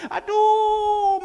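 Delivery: long high loud wail of aduh; imitated wail. Widow cries out again to the pastor, high and loud.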